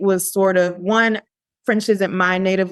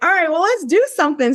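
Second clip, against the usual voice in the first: eager self-quote; 10 semitones higher, faster than usual. Retold or imitated.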